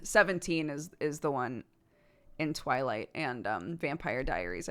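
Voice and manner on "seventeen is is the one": normal talking voice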